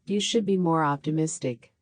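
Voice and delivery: ordinary talk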